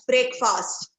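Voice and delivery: breakfast as brake-faast; breakfast with both halves fully sounded; wrong pronunciation. Shown as a demonstration. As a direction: say breakfast slowly as two separate words. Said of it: "When you put together, it becomes".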